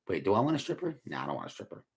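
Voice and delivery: normal talking voice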